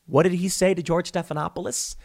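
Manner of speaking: ordinary talk, nothing marked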